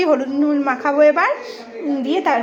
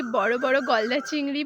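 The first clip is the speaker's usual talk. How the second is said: ordinary talk, nothing marked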